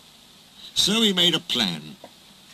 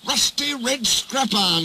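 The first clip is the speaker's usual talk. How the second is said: high-pitched offended complaint; indignant hiss; 10 semitones higher than usual. A narrator's reading. James reports his nickname to the controller, indignant hiss. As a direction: spit out the insult, affronted. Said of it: "hissed James".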